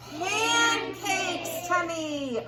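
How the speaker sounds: slow, high, loud reveal; little boy's triumph. Acted. Little boy solving the riddle, high and slow. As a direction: sing it out like a proud child.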